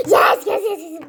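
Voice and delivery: giddy teary yes; overjoyed shout. Acted. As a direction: cheer with relief. Overjoyed getting bag back.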